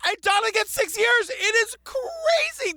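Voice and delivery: high, slow, near-crying disbelief; incredulous outrage. Real, not acted. Telling listeners about the sentences, incredulous wail. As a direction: wail it like you can't believe it.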